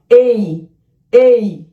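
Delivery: put-on sounding out a letter for children to repeat; phonics sound. Shown as a demonstration. Teacher modelling a letter sound for young learners. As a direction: say it slowly for kids to echo. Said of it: "আমার সাথে বলো".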